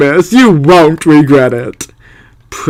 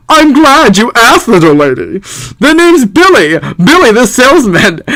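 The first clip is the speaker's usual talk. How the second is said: salesman voice; 8 semitones higher, 3 dB louder than usual. Acted. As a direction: greet her like a showman. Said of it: "Czech voices".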